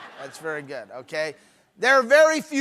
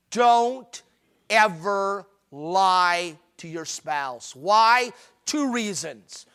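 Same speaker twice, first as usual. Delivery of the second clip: ordinary delivery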